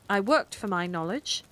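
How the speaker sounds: ordinary delivery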